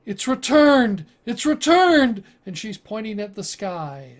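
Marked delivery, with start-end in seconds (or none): 0.0-2.2 loud, high cry of alarm; woman screaming. Acted. Woman screaming at the sky on deck, screamed alarm. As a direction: scream it out, drawing out the second word. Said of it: "there's this woman screaming".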